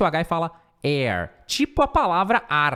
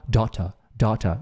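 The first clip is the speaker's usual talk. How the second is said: daughter in a British accent, dó-tuh with a clear t; 7 semitones lower than usual. Shown as a demonstration. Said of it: "No inglês britânico, eles falam".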